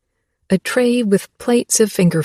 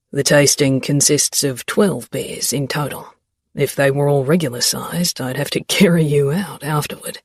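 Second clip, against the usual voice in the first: lightly lowered male voice; a hint of a teasing young man; Xavier voice; 5 semitones lower than usual. A narrator's reading.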